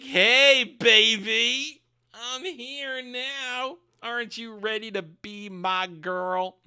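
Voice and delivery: hammed-up come-on in a put-on voice; Sauron. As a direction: drag out the words like a cheesy seducer. Sauron voiced as sleazy suitor.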